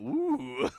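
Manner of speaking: high, loud mock "woo"; imitated swooning woo. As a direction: imitate an audience of girls going woo. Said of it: "Every girl in here just went".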